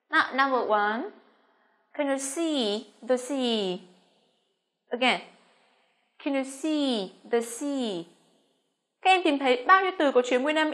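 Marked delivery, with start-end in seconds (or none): 1.9-3.8 slow, measured English for learners; slow model reading. Shown as a demonstration. Teacher reading for students to listen carefully, slow model sentence. 6.2-8.0 slow, measured English for learners; slow model reading. Shown as a demonstration. Teacher reading for students to listen carefully, slow model sentence.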